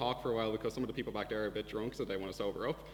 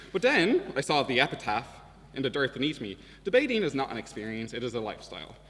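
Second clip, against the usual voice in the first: louder, grand mock-dramatic narration; mock-epic build; 2 semitones higher, 8 dB louder than usual. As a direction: swell it like a parody epic. Mock-epic debating tale, rising.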